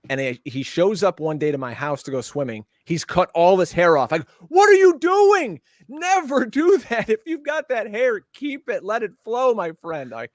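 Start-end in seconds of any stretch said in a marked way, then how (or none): 4.5-10.1 high, loud, slow incredulous cry that fades by the end; hammed-up disbelief at a nephew's haircut; mock outraged uncle. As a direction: uncle pleading with his nephew, much higher and louder at the start.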